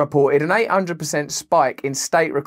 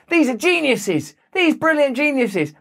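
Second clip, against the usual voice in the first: higher-pitched, exaggerated admiration; mock awe; 9 semitones higher than usual. Commentator imitating the stories told about tech bosses, mock awe. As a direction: parrot the official story with fake wonder. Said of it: "We're told stories".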